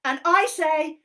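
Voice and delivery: high, loud cheeky monkey voice; squeaky puppet monkey helping Helen. Acted. Monkey puppet explaining a game to Helen, high and bouncy.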